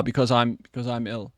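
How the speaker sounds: ordinary delivery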